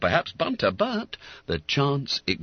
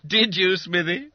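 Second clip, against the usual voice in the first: loud, higher-pitched amused challenge; roared; 10 semitones higher, 4 dB louder than usual. Acted. Schoolmate to Smithy after the trick, roaring. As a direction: call it out across the group. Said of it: "roared Bob Cherry".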